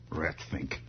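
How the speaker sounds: low, soft muttered insult. Real, not acted. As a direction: grumble it under your breath.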